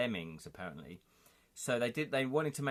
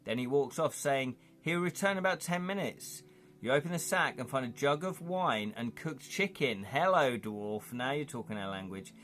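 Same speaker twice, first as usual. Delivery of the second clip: lively storybook read-aloud, louder; game narration; 5 semitones higher, 4 dB louder than usual. Acted. Streamer reading the dwarf scene to chat, lively narration. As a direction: read the gamebook scene to your audience. Reads adventure text to viewers.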